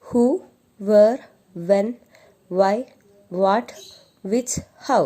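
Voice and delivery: each word read separately with a rise; reading list aloud. Shown as a demonstration. Teacher repeating question words with the pupils, emphatic. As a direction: call out each word slowly for the class. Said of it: "Read it once again".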